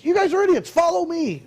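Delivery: high, gruff put-down with a grin; mock yelling. Helpful driver telling them to follow him, high mock yell. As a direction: bark it like a rude but helpful New Yorker. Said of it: "yelling at us".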